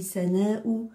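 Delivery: Sana'u said slowly with a light, thin S; teacher drawls Sana'u to show the light Sin. Shown as a demonstration. Teacher shows the children the light S, slowly. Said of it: "Sin, il est léger".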